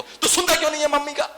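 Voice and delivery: high, fast, loud scolding with a laugh breaking in; angry father yelling. Acted. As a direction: scold the son as you hit him. Father slaps and scolds son. (ऊँची तेज़ आवाज़ में डाँटता पिता)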